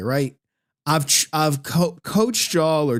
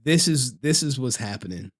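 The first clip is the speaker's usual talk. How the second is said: low, softer heart-to-heart voice; opening up; 6 semitones lower, 4 dB quieter than usual. Acted. Streamer imagining sharing his life with chat, confiding. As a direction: open up quietly, pitched down. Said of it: "there are times where I wanna be like".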